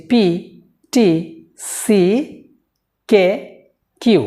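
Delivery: পি, টি, সি, কে, কিউ said as plain letter names; letter names without aspiration. Shown as a demonstration. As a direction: read the letters plainly, no puff. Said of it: "আগে আমি ভুলটাই বলছি".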